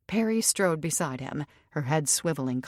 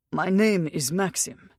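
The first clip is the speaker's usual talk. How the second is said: put-on louder, emotional thick Russian accent; Russian accent, words swamped by emotion; 3 semitones higher, 5 dB louder than usual. A narrator's reading. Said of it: "His accent was thick with his Russian tongue".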